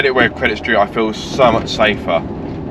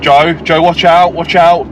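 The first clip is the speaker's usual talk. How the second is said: hammed-up alarm, a bit louder and higher; mock warning shout; 5 semitones higher, 5 dB louder, slower than usual. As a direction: pretend panic calling out to Joe. Mock alarm entering 30 zone.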